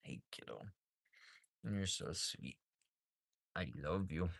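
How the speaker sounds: a gentle hush for a calf; soft pet talk. Real, not acted. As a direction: murmur it like soothing an animal. Cooing to the calf.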